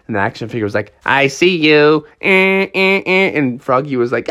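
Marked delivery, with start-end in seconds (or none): 1.0-3.3 slow sing-out, then little menacing noises; creepy found-you taunt; taunting toy villain. Acted. Action figure finding Froggy in hiding, taunting.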